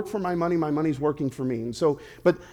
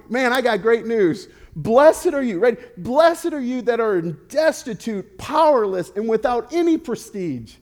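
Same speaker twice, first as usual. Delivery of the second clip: higher and louder, beaming with great news; smiling, thrilled Jesus; 7 semitones higher, 6 dB louder, slower than usual. Retold or imitated. Jesus tells the poor crowd the good news, higher and louder with joy. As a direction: announce it like the best news they have ever heard. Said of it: "do you think he was smiling somewhere along the line".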